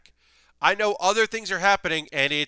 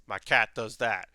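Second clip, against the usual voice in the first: a hint of a tough yakuza-style cat, lowered; deep cat voice; 5 semitones lower than usual. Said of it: "a real deep voice".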